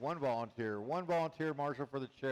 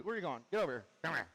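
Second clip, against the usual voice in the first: normal talking voice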